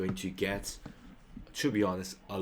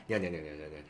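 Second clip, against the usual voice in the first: 你好 in a rapid run, dropping away softer at the end; quickly repeated; 6 semitones lower, 4 dB quieter, much faster than usual. Shown as a demonstration. Showing how native people say hello, quick casual repetition. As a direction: rattle off 你好 several times, slurred together. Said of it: "quickly com-, replicate this".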